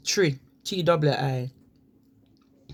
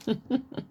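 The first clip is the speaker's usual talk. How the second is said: light laugh; 7 semitones higher, 5 dB quieter than usual. Real, not acted. Laughing after accent demo.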